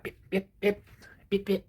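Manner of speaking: high, quick beep beep like a car horn; imitated car horn beeps. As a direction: mimic a horn tapping. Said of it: "is what it sounds like".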